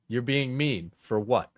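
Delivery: ordinary talk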